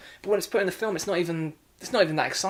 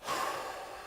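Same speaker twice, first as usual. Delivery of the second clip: long, soft exhaled sigh; weary sigh; 10 dB quieter than usual. Real, not acted. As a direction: let out a long, soft breath of dismay. Reviewer fed up with the film.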